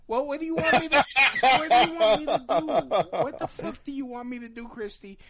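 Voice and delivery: loud, high frustrated yelling; exasperated shout. Real, not acted. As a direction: yell it in total exasperation. Exasperated rant at Christy.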